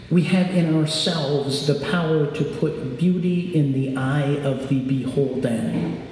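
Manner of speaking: ordinary delivery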